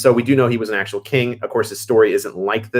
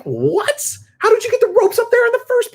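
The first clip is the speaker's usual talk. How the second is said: very high, squeaky outraged question; incredulous; 3 dB louder than usual. Real, not acted. Fan rants at the illogical battle, squeaky outrage.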